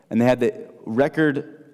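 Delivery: usual speaking voice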